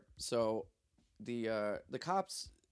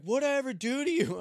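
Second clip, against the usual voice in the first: high, loud imitated complaint; mock aggrieved protest; 13 semitones higher, 9 dB louder than usual. Roasted cop complaining to the prisoner, mock aggrieved protest. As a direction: protest loudly as the wounded butt of jokes.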